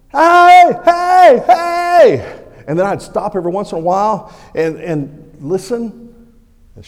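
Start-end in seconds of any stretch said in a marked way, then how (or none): 0.1-2.4 full-voiced high shouts for help; desperate yelling. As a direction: yell across the woods to be found. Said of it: "I started yelling".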